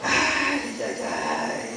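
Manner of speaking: lamento dramático de Dios; ay hondo y quejumbroso, de más agudo a muy grave y suave; God lamenting. Acted. El Señor se lamenta por Israel. (Dios se lamenta por su pueblo, quejido lastimero)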